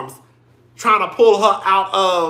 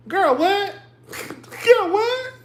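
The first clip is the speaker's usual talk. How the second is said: high, drawn-out incredulity, climbing higher; 11 semitones higher, much slower than usual. Real, not acted. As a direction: disbelief rising to a squeal. Reacting to Candace being blamed.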